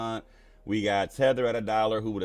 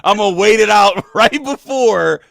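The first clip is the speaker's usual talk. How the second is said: loud, high, laughing through words; loud giggly banter; 9 semitones higher, 13 dB louder than usual. Real, not acted. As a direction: laugh it out while teasing. Laughing about haircuts.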